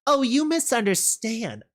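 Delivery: high, mock-sweet correction; taunting villain. Rainbow Dash toying with the rebel, taunting. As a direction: toy with your opponent.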